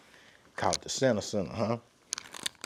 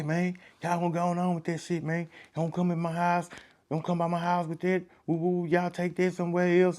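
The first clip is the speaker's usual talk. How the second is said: a higher-pitched warning to back off, speaking as Fly; warning homeowner; 7 semitones higher than usual. Retold or imitated. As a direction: shoo the crowd away from your house. Fly warns crowd off his house.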